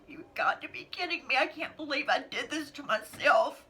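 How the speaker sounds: whispered then cried out, high; tearful despair. Real, not acted. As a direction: break down in tears. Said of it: "I'm like".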